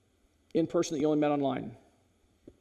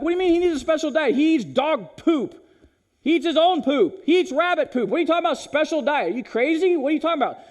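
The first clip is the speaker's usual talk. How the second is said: high, loud, incredulous ranting at the vet; dog owner scoffing at the vet's special diet, high and loud; mock outrage; 11 semitones higher, 5 dB louder than usual. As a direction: blow up at the vet in disbelief.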